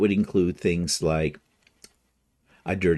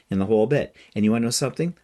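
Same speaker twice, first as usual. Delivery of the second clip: normal talking voice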